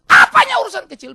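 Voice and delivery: loud, high indignant shout; acted outburst of anger; angry. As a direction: explode at the doctor's dismissal. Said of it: "Marah dia".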